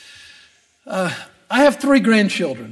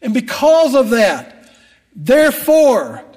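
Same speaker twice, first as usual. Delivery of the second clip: preaching; 3 semitones higher, 3 dB louder than usual. Acted. Preaches to the congregation.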